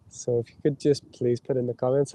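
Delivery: normal talking voice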